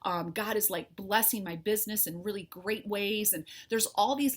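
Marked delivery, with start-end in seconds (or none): none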